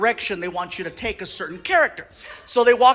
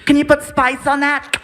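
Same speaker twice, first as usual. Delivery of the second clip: higher, boxy talkback voice; director over PA; 4 semitones higher, 3 dB louder than usual. Retold or imitated. Director to voice actor behind glass, tinny PA voice. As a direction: imitate a brisk director through a studio speaker. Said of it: "talk to me through a P A system".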